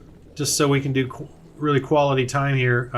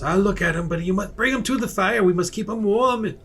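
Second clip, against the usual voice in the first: higher, earnest villager asking for the fire; 6 semitones higher than usual. Acted. Game master voices villager telling party to warm friend, higher. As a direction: voice the helpful local, pitched up. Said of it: "one of them says".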